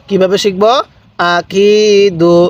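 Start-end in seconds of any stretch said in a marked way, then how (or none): 1.2-2.4 আকিদু very slow, কি held long and level; one-alif elongation. Shown as a demonstration. Teacher showing how to read, drawn-out আকিদু. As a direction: chant আকিদু, stretching the middle কি. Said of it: "এক আলিফ টানতে হয়".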